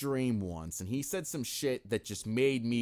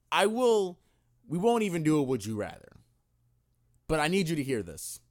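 announcer build-up; 3 semitones higher, 4 dB louder than usual. Acted. Announcing a segment dramatically.

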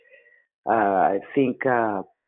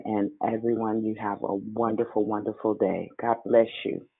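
ordinary talk, nothing marked

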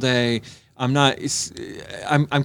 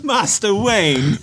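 slow, higher mock English butler; 6 semitones higher, much slower than usual. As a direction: intone it like a stuffy butler.

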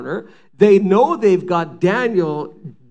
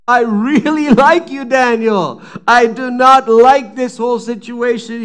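torn king; 5 semitones higher, 7 dB louder than usual. Acted. King voiced, torn over Daniel.

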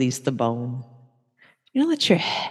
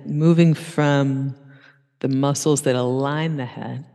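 normal talking voice

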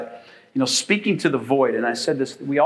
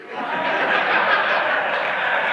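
burst of laughter. Real, not acted. Laugh after the punchline.

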